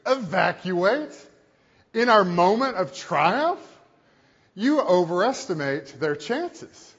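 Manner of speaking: a lightly voiced imperial commander scoffing; imitating Tarkin. Tarkin to the officer, brushing off the warning: mock scornful. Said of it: "and he goes".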